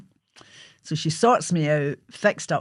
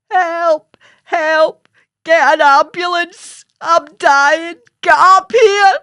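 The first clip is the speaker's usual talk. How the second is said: frantic high screams at full volume; screaming for help; 11 semitones higher, 11 dB louder, much slower than usual. Retold or imitated. Child screaming down the stairs to her mother, frantic. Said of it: "So I'm screaming down the stairs to my mother".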